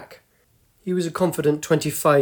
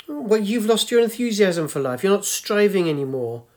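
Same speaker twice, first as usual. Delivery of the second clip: put-on higher, reproachful old lady; chiding old woman; 6 semitones higher, 3 dB louder than usual. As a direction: scold the young man gently. Old woman chides him.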